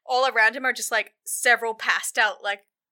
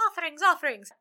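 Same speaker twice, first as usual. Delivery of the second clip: lightly voiced crowd, pitched up; worshippers' chorus; 4 semitones higher, faster than usual. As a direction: chant it like a humble crowd. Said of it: "Everyone's like".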